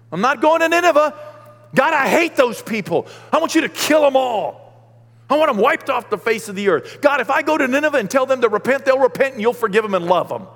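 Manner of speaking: high, loud furious ranting; Jonah's angry rant. Acted. Jonah refusing God, ranting.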